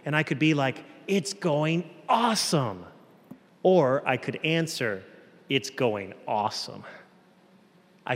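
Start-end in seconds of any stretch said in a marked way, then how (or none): 1.1-2.9 higher, emphatic "awesome"; really mean it, upbeat and emphatic; sincere enthusiasm. Shown as a demonstration. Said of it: "It's really going well". 5.5-6.8 dry, unenthused sarcasm; sarcastic. Shown as a demonstration. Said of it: "I was being sarcastic".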